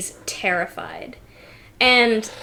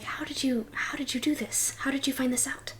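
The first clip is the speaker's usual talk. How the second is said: hushed amazed whisper; 3 semitones higher, 12 dB quieter, faster than usual. Acted. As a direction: ask it under your breath. Duchess whispers question.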